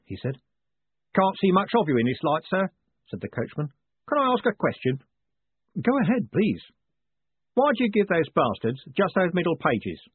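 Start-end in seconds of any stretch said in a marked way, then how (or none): 1.1-10.0 higher-pitched rough-and-ready driver; chatty coachman. Acted. Coachman chatting to his passenger, high chatty voice. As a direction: play a cheeky, familiar coachman.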